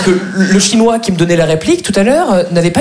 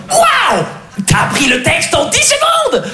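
amazed; 13 semitones higher, slower than usual. Retold or imitated. Amazed colleague.

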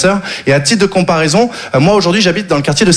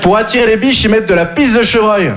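louder, higher retelling; louder repeat; 4 semitones higher than usual. Shown as a demonstration. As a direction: hammer it home louder. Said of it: "Je vais répéter parce que vous avez pas assez réagi".

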